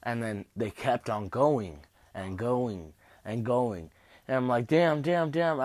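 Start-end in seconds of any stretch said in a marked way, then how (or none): 4.7-5.6 retold pained cursing. Retold pain as staples continue.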